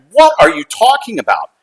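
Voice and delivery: high-pitched, baffled demand; retold astonishment, pitch leaping up; incredulous. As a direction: question it in sheer disbelief. Acted disbelief at mother's claim.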